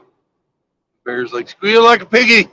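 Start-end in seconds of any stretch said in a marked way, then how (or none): 1.5-2.5 much higher, squealy mocking command; hammed-up high taunting bear voice. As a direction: sneer it up high like a leering brute.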